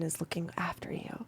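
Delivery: hushed and secretive; whisper. Acted. GM hinting at the deity in a whisper. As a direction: breathe it as a hint.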